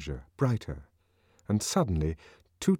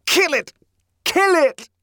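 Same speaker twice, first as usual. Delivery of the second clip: frenzied shout; 8 dB louder than usual. A narrator's reading. Savage whipping himself.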